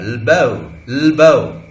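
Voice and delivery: "लबो" opening on a long bare l, then a loud bow; syllabic l. Shown as a demonstration. Teacher drilling the dropped vowel, syllabic l. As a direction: hold the l alone, then hit "bow" in "लबो". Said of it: "ल अकेला जो है".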